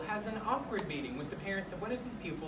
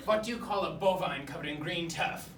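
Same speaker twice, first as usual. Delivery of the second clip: mock-Russian riddle and punchline; hammy Russian joke, punchline punched out; Russian accent; 6 dB louder, slower than usual. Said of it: "tell it in a Russian accent".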